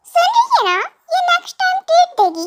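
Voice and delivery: high, sing-song teasing "treat देगी"; mocking announcement. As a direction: call it out to mock the stingy friend. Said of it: "मैं ना next time treat दूंगी". (चिढ़ाते हुए ऊँची आवाज़ में)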